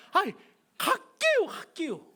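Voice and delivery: high, loud whiny plea; impatient plea. Retold or imitated. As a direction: beg to be released. Son begging to go. (높고 크게 조르는 아들)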